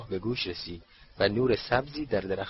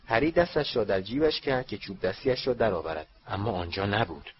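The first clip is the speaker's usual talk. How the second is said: usual speaking voice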